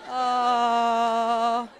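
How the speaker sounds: long, low, sustained "oh"; drawn-out oh. Acted. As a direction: hold one low, steady oh. Drawn-out reaction sound.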